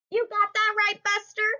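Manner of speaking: high, sassy cartoon voice; playful character voice. Parent reading aloud, character answers narrator cheekily. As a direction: toss it back with cheeky pride.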